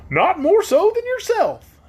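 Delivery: very high, slow straw man; squeaky and amused; Scarecrow voice. A narrator's reading.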